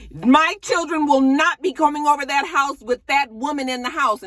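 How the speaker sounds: high, loud, indignant declaration. Retold or imitated. Ex-wife forbidding the kids, high and loud. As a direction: put your foot down, high and loud. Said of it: "she was just like".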